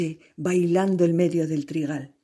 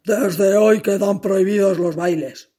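a louder, lightly voiced grumpy master; a hint of a bitter old boss; 5 dB louder than usual. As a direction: lay down the law, curt. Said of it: "le dijo un día".

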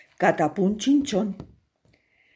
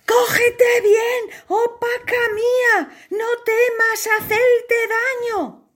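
much higher, loud, slow urgent call; urgent rescuer shout; 4 dB louder than usual. Acted. Hero calls to the princess in the water, urgent. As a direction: urge her to hold tight.

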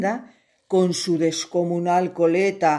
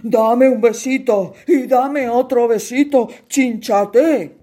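louder, tender emotional king; moved king; 5 semitones higher, 7 dB louder than usual. Acted. King, shaken, thanks the hero, moved. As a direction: ask for kisses warmly.

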